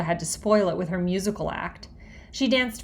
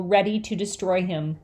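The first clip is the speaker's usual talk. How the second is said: ordinary delivery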